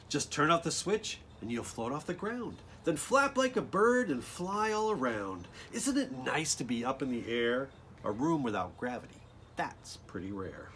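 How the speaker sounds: lively, sing-song storybook read; bouncy picture-book reading voice; reading to children. Acted. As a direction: bring the rhymes alive for the kids. Reads the rhymed book text.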